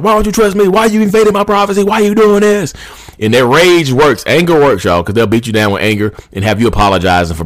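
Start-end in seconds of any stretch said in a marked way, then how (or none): 0.0-2.7 high, strained outraged protest; put-on screechy indignation, pitched way up; mock raging scream. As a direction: imitate a cheater blowing up in rage. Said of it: "go into a rage and scream like".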